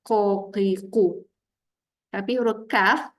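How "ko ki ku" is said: "Ko, ki, ku" with a heavy q from the back of the tongue; thick Arabic qaf on each syllable; heavy deep qaf. Shown as a demonstration. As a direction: stick the deep tongue root for "Ko, ki, ku". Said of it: "jadi berat dekat dalam".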